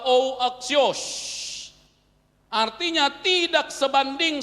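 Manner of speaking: high, loud emphatic sermon; full-force preaching. Acted. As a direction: drive the point home from the pulpit.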